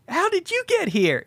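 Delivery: high-pitched astonished question; mock bewilderment. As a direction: ask it baffled, hammed up. Rod startled to see friends.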